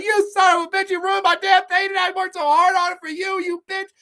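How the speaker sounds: high-pitched hammed-up furious rant; enraged outburst. Painter to the woman who ruined his painting, hammed-up rage. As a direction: rage loudly at the one who wronged you, voice pushed high.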